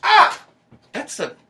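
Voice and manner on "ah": high pained yelp; just got slapped. Acted.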